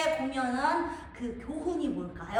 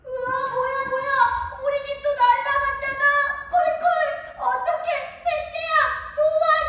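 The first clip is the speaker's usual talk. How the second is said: much higher, louder panicked calls to a brother; a frightened pig begging the third pig for help; terrified piglet; 11 semitones higher, 5 dB louder than usual. Acted. Second house blown down. (셋째에게 도와달라고 외치는 겁먹은 돼지)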